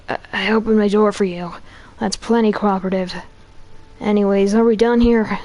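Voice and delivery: ordinary talk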